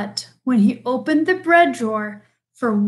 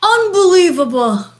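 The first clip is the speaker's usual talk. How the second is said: loud, high-pitched exasperation; bear's outburst; 6 semitones higher, 7 dB louder than usual. A narrator's reading. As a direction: exclaim it as an outraged bear. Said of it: "rumbled Bear".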